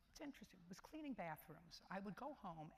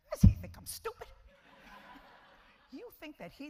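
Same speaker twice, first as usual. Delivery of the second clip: incredulous outburst; 10 semitones higher, 18 dB louder than usual. Retold or imitated. Judge scoffs at teen's excuse.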